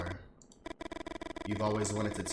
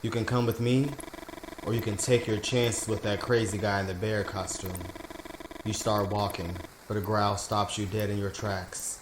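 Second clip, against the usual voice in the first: ordinary talk, nothing marked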